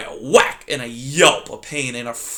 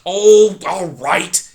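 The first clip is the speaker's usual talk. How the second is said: growled; 5 semitones higher, 8 dB louder, much slower than usual. Acted. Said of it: "he growled".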